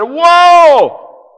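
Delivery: high, loud amazed whoa; astonished whoa. Retold or imitated. Astonished disciples after the calm, loud amazed whoa. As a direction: gasp in wonder. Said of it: "the disciples were astonished".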